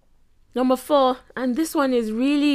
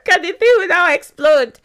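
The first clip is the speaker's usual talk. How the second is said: higher, louder, laughing words; talking through laughter; 8 semitones higher, 5 dB louder than usual. Real, not acted. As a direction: keep talking as the laugh carries on. Still laughing at the acted quarrel.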